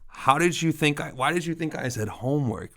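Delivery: lightly voiced teasing child, no real laughter; imitating mocking daughter. Daughter making fun of dad's mishearing, mocking. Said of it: "she laughed at me".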